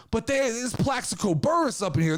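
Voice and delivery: ordinary delivery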